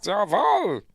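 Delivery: put-on high, loud German accent; German radio voice. German caller on the radio, loud mock German accent. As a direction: shout it as a hammed-up German officer. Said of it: "this German voice comes on".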